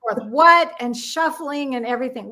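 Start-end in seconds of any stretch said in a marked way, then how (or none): 0.3-0.7 loud, higher-pitched query; imitated baffled phone shout; can't hear you. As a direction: shout back down a crackly line. Back and forth on bad line.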